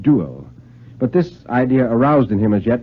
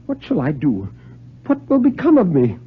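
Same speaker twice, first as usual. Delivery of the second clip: rising to a much higher, panicked question; frightened; 6 semitones higher than usual. A narrator's reading. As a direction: voice climbing in fear. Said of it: "His whole body trembled spasmodically".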